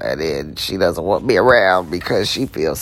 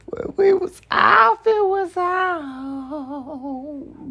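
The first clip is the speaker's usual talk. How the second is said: much higher, slow falsetto repeat; high mock sing-song; slower than usual. Real, not acted. Teasing line repeated up high, falsetto.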